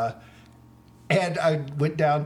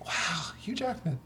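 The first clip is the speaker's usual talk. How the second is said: breathless starstruck whisper; awed whisper; 8 dB quieter than usual. Retold or imitated. A starstruck fan spotting Hugh Jackman nearby, awed whisper. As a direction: hush it like he might hear you. Starstruck aside near the star.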